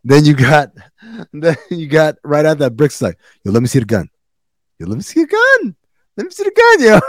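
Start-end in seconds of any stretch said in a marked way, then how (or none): 4.8-7.0 very high-pitched, whiny kid imitation; childish begging. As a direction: mimic a child pestering for a toy.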